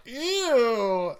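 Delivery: grossed out. Retold or imitated. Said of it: "you'll be like".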